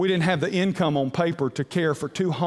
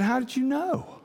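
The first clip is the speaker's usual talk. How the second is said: surprised wife; 9 semitones higher than usual. Retold or imitated. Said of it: "And she said".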